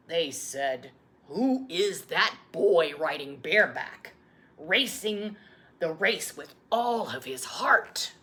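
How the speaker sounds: a patient grandfather answering; grandfather's voice. Acted. Grandfather quotes the people.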